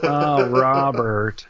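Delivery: slow, nasal whine on "Oh, Robert"; Ray Romano impression. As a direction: stretch out "Oh, Robert" in a drawn, whiny nasal voice.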